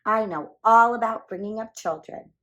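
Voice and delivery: a self-assured mother duck; confident. A narrator's reading. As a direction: boast of being a good mother.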